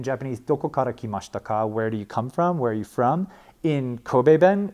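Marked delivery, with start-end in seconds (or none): none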